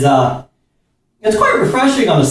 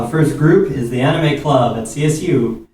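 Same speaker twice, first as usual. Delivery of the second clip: ordinary talk, nothing marked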